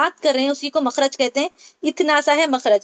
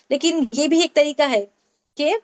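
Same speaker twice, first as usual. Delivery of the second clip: ordinary talk, nothing marked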